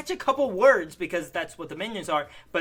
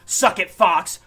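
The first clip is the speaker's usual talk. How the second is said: louder cocky taunt; mock taunt; 3 semitones higher, 9 dB louder, slower than usual. Vlogger quoting Deadpool to camera, loud taunt. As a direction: shout it like a victory jeer. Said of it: "doing a headbutt and saying".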